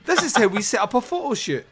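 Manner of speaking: high, fast mock vlogger intro; hammed-up channel presenter pitch; YouTube tutorial presenter. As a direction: chirp it like an online how-to host. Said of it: "For their YouTube channel".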